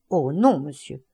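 a hint of a stern, pious maid; mildly hard refusal, trailing off; understated prim severity; devout and stern. A narrator's reading. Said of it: "avec son air dévot et dur".

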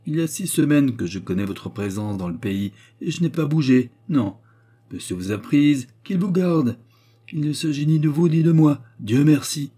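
riposte du mari, de plus en plus haute; répliquer vertement, en appuyant les fins; réplique verte. A narrator's reading. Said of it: "répliqua-t-il vertement". (réplique verte)

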